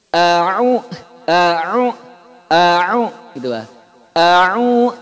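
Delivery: a'u with hamzah then ain; correct a'u. Shown as a demonstration. Teacher showing the correct a'u. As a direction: separate a and 'u clearly. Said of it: "kayak gini".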